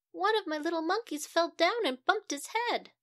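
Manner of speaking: high, loud worried mom; frantic mother. Acted. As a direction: blurt out the bad news. Mother reports another injury.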